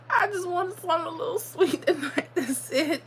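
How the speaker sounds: high, laughing at herself. Real, not acted. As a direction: giggle through the confession.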